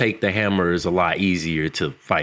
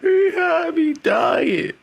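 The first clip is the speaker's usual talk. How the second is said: real laughter; much slower than usual. Real, not acted. Podcaster recalling a funny scene, squealing with laughter. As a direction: shriek it through real laughter.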